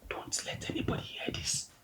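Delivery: secretive whisper; father whispering a secret to his daughter. Acted. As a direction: hush it so no one hears.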